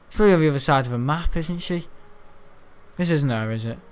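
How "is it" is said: normal talking voice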